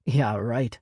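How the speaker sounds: ordinary delivery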